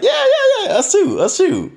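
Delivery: eager husbands. Retold or imitated. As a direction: gush agreement like an overeager guy. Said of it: "then they're like".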